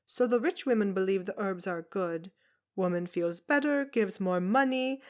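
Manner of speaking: put-on a tiny old foreigner scoffing at washerwomen; brusque foreign woman. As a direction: Zelda mocking the town's washing, loud and brusque. Zelda's voice held.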